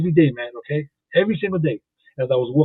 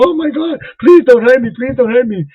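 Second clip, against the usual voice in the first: much higher and louder mock pleading; hammed-up panicked lady; 9 semitones higher, 7 dB louder than usual. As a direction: beg in exaggerated panic.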